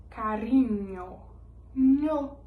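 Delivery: carinho with ñ like Portuguese nh; carinho, stress the nh sound on nho; Spanish eñe. Shown as a demonstration. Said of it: "o N H vira o N".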